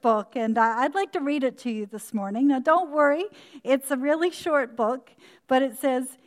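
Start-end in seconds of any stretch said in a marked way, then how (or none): none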